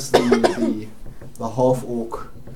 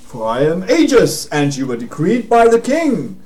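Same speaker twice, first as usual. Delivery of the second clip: loud, higher-pitched heroic proclamation; pompous paladin; 7 semitones higher, 8 dB louder than usual. Acted. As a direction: declare it with royal authority. Game master plays Aegis proclaiming authority.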